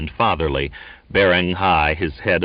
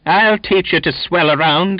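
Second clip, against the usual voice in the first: high, loud taunting yell; 11 semitones higher, 6 dB louder than usual. A narrator's reading. Donkin squaring up to the Finn, yelling. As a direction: yell it in his face. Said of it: "he yelled".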